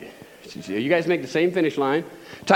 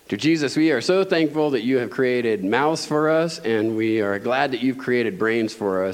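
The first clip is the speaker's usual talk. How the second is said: prayer; 5 semitones lower than usual. Acted. Leads closing prayer.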